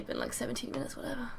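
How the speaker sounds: breathy whispered aside. Real, not acted. Gamer muttering about time spent, whispering. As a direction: drop to a whisper.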